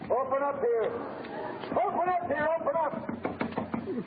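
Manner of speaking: insistent knock-and-call at the door; calling through the door. Acted. Police pounding at the door.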